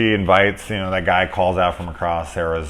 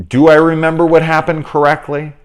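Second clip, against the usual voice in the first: higher, louder puzzled wondering; confused self-questioning; 10 semitones higher, 9 dB louder than usual. Retold or imitated. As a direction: ask yourself in disbelief. Julie's confused thought voiced.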